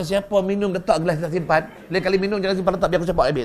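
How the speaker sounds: lakonan suami membebel marah dengan suara kuat. Acted. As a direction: marahkan isteri dengan suara tinggi dan lantang. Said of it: "Suami juga marah". (marah lantang)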